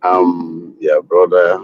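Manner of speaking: normal talking voice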